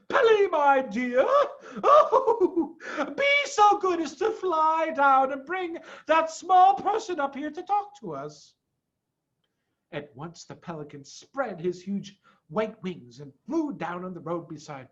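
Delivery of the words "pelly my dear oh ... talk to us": high, laughing, sweet voice; giraffe character voice. A narrator's reading. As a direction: play the gracious giraffe, giggling. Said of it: "the giraffe saying".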